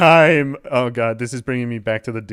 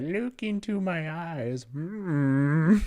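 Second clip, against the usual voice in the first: slow, soft, higher-pitched mock hypnosis; creepy hypnotic stare voice; 7 semitones higher, 3 dB quieter, much slower than usual. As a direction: lure the viewer in, slow and hushed. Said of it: "She's just like".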